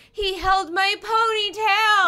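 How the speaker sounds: slow, high, loud climax; hammed-up horror; melodramatic reveal. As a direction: build to the shocking reveal. Reader melodramatically reveals the loss.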